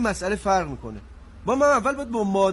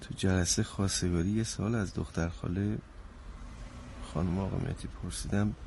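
sheepish low confession; 13 semitones lower, 10 dB quieter than usual. Acted.

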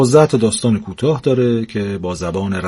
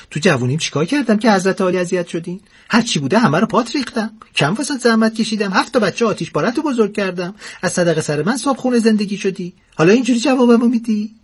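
much higher aggrieved tirade; indignant old wife; 11 semitones higher, faster than usual. Acted. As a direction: list all her sacrifices in outrage. (پیرزن دلخور با صدای خیلی زیر)

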